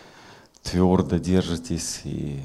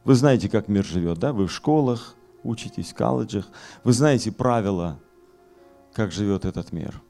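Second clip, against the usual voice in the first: raised, forceful pulpit voice; fiery preaching; 9 semitones higher, 6 dB louder than usual. Acted. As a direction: preach it hard to the hall.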